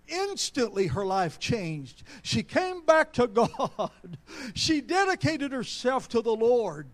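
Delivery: loud, impassioned pulpit delivery; full-force preaching. Real, not acted. Preacher proclaims the daughter's return to God, fervent preaching.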